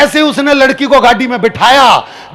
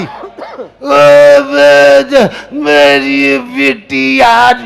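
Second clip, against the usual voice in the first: put-on old grandmother lament; much slower than usual. Grandmother mourning farewell.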